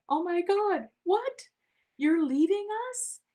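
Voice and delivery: mock shock. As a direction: play shocked disbelief at the news. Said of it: "I immediately texted and went".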